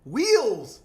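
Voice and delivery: "Wheels" said high, loud and drawn as one clear word; emphatic repeat. Retold or imitated. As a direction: hammer out "Wheels" in exasperation. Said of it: "wait, what are you saying".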